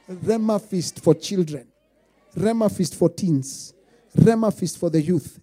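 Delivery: loud, slow, measured proclamation; slow and ringing; slow announcement. Acted. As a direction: announce each event grandly. Announcing the conference.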